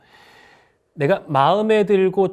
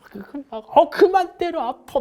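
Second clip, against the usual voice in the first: much higher squealing tease; playful girlfriends; 12 semitones higher, 4 dB louder, slower than usual. Retold or imitated. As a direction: playfully whine at a friend who's hitting you. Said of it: "여자분들 많이 하시잖아요".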